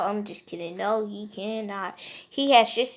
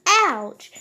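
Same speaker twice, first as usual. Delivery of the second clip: a hammed-up yelp, higher and louder; mock ouch; 6 semitones higher, 8 dB louder than usual. Toby, stabbed in both legs, mock yelps ouch. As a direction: toss off a fake cry of pain. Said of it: "but he says".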